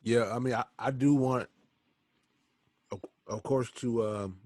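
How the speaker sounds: talking with gum in mouth; chewing gum. Real, not acted. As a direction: speak around a wad of gum. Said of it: "I got this gum in my mouth".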